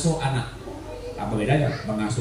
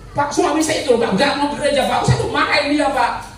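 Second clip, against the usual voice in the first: higher-pitched pleading congregant voice; complaining wife; 8 semitones higher than usual. Retold or imitated. Wife complaining to the pastor about her husband, imitated high.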